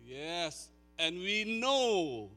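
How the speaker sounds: high, loud, fervent preaching; preaching at full force. Acted. Pastor preaching Romans eight loudly to the congregation. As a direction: drive the promise home to the room.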